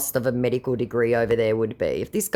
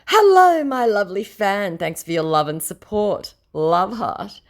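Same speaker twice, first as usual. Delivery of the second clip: high, louder mock-sweet greeting; fake Chris Hemsworth; 8 semitones higher, 5 dB louder than usual. Fake Chris Hemsworth greeting his fan, in a high mock-sweet voice. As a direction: ham up the scammer's fake charm. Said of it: "me Chris Hemsworth private chat".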